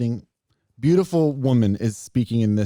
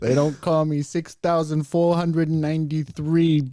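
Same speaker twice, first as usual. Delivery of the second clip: louder, slow mock-Brooklyn boast; Brooklyn accent; 6 semitones higher, 4 dB louder than usual. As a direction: announce the nickname in put-on Brooklynese. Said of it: "would love to have a Brooklyn nickname".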